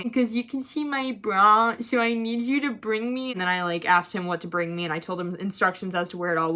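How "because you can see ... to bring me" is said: whimpering imitation of an upset kid; put-on weepy voice; mock tearful plea. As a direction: whine a request to dad. Tearful student pleading with dad.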